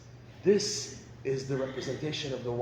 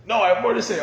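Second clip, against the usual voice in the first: a louder, higher wife still venting; 6 semitones higher, 9 dB louder, much faster than usual. Retold or imitated. Wife insists to husband she isn't finished, louder and higher. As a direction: push back, she isn't done yet.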